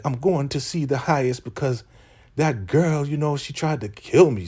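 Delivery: high-pitched mock complaint; imitated complaint. Recapper voicing Elder Mother accusing a girl, high mock complaint. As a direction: put on a high voice for an aggrieved character.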